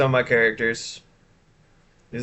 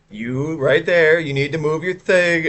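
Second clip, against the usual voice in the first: slow, loud, higher bark at a passenger; stern conductor; 5 semitones higher, 5 dB louder than usual. Retold or imitated. Conductor ordering a passenger to move his bike. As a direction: call him out slowly and firmly.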